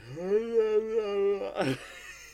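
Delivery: high yawning laugh; laugh after a yawn. Real, not acted. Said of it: "I don't know why I yawn so much".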